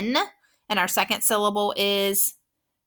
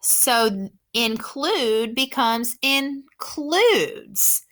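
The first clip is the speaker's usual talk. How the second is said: "includes" stretched slowly with the final S brought out; slow stressed suffix; much slower than usual. Shown as a demonstration. Teacher slowly showing the suffix added to the word. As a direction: draw out "includes" so the added S stands out. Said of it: "I'm just gonna use the S".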